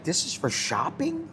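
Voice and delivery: high-pitched question rising in retold disbelief; incredulous. Husband reacting to strict rules for shopping, incredulous. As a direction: act out disbelief, voice climbing at the end.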